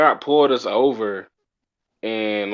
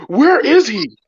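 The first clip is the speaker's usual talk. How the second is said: loud, high-pitched frantic insistence; tripping-out cop; 14 semitones higher, 6 dB louder than usual. Retold or imitated. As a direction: act out a cop demanding the missing man. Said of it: "this dude is, like, tripping out".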